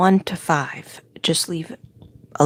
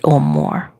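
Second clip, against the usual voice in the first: dropped much lower, a mock deep read of "or more."; "or more." said low and drawn as if writing it. Shown as a demonstration. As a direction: drop way down in pitch for "or more.". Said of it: "I might have to write".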